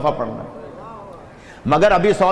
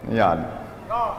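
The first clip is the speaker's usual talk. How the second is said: astonished gasp; 7 semitones lower, 7 dB quieter, much slower than usual. Acted. Astonished cry, rescuer vanished.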